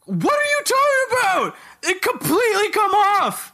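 high, incredulous outcry; can't believe what you're hearing; shocked disbelief. Real, not acted.

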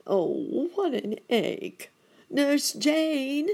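high, loud call for Nurse Jane; calling out in pain. A narrator's reading. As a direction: cry out to the nurse upstairs. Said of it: "he called".

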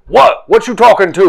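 indignant. Acted. Indignant reply in banter.

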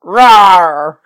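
loud playful dinosaur roar; playful monster growl. Retold or imitated. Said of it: "I'm going to be a dinosaur".

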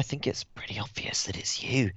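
hushed whisper. Acted. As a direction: whisper it gently. Whispering in character.

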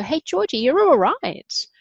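tender inner cheerleader, slowed and lifted; kind inner voice. Retold or imitated. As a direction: coax yourself gently like a loving friend. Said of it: "harness this voice that would go".

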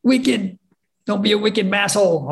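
light Boston accent imitation; playful Boston accent. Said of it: "I had this Massachusetts accent".

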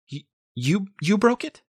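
high, halting hurt question; upset disbelief. A narrator's reading. Mother asks boyfriend about pot, high upset stammer. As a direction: stammer it, pitched right up.

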